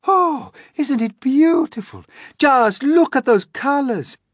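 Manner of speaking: high, loud delight; thrilled, full-voiced cries; excited shouting. A narrator's reading. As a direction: shout in rapture, then call out. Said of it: "they shouted".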